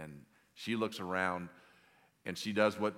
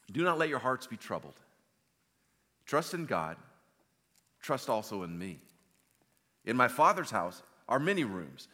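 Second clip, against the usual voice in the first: loud, raised, declamatory reading; scripture reading; 4 semitones higher, 7 dB louder than usual. Acted. Preacher reading Jesus's words from John fourteen, loud proclamation. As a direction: proclaim each phrase firmly to the whole room. Reads scripture aloud to congregation.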